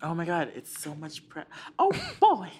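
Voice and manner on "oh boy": high, squeaky cartoon mouse; chirpy Disney mouse voice; Mickey Mouse voice. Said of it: "I can do Mickey Mouse".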